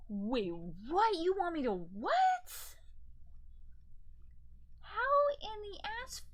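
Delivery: real incredulous outburst, drawn out and climbing high; baffled disbelief. Real, not acted. Crafter reading impossible turning instructions, baffled disbelief. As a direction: stretch the words in rising disbelief.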